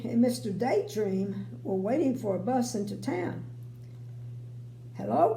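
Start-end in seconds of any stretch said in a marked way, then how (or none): 4.9-5.3 cheerful hello. A narrator's reading. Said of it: "grinned Mister Happy".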